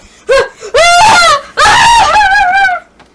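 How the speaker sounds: high, loud panicked shriek; shrieking scream. Acted. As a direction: scream in shock. Screaming laugh in fright.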